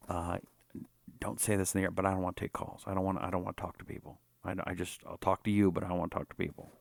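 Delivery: low, soft, confiding aside to the host; mimicking a politician. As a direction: slip into a hushed, off-air politician's voice. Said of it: "is they say".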